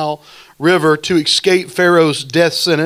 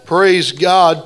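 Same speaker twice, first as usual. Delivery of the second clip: usual speaking voice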